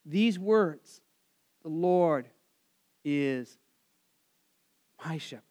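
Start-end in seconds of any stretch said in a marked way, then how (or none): 1.6-5.4 quiet recitation. Acted. Preacher savours the psalm words, fading into a hush. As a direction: let each word settle in silence.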